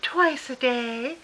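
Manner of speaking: slow, sugary Southern mother; sweet Southern voice. Retold or imitated. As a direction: draw it out in innocent Southern sweetness. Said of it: "so sweet butter wouldn't melt in her mouth Southern voice".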